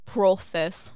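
Canadian long O in process; Canadian pronunciation. Shown as a demonstration. Showing how you can tell someone's Canadian, process with a long O. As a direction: say process as "PRO-cess", Canadian style. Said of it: "way that they say P O R words".

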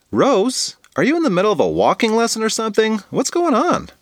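high-pitched, incredulous questioning; astonished classmate's greeting; surprised kid. Acted. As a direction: call out in puzzled surprise, pitched well up. Classmate surprised to find Rose outside.

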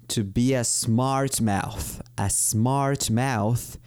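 "To be a smart mouth." said very slowly; drawn-out teaching phrase; slow model phrase. Shown as a demonstration. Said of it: "En inglés digo". (frase dicha muy despacio)

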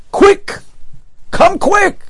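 high, urgent shout of quick; urgent call. Retold or imitated. Student hurries the Rosh Kolel, high urgent call. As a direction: hurry him along, breathless with news.

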